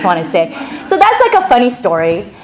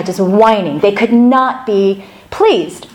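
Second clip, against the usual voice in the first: ordinary delivery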